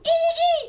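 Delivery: high, loud monkey squeal. Acted. Baby monkey swinging back and forth, high squeal.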